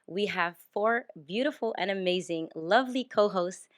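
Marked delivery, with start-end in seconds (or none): none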